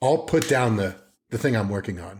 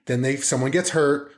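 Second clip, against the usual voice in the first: ordinary delivery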